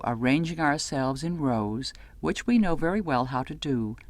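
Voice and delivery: usual speaking voice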